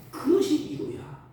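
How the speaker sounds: ordinary delivery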